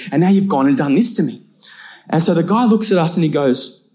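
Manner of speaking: ordinary talk, nothing marked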